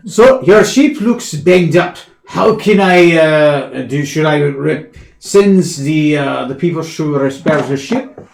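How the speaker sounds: put-on dock master putting on a clumsy German accent; mock German accent, slow at first; terrible German accent. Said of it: "I have a terrible German accent".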